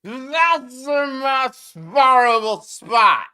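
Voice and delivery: high, loud, slow slurred mimicry of Trump; mock slurred Trump. Vlogger mocking Trump's slurred speech, loud and slow. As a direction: drag the words out like a slurring old man. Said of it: "slurring his words".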